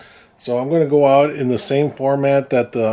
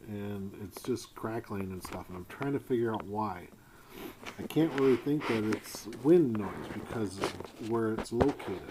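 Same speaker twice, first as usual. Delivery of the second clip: quiet; 14 dB quieter than usual. Real, not acted. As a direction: talk softly while fiddling with the wire. Quiet talk while checking footage.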